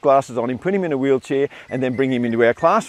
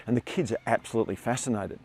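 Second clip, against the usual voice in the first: ordinary talk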